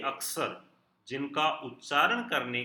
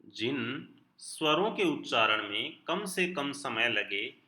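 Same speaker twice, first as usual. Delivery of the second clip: ordinary talk, nothing marked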